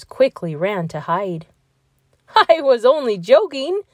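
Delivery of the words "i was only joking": called out. Acted. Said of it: "called the big tan animal".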